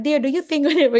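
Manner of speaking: high, loud wifely question; playfully consulting a husband; coaxing question. Acted. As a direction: put it to your husband as a leading question. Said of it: "the, uh, question form".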